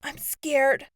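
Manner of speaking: frightened, small and faraway voice; small and scared. A narrator's reading. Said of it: "She sounded small and distant".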